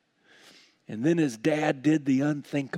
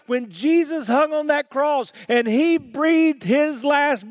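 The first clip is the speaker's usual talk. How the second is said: high, loud, impassioned sermon; triumphant pulpit proclamation; full-force preaching; 10 semitones higher, 6 dB louder than usual. Real, not acted. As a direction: preach it up to a ringing peak.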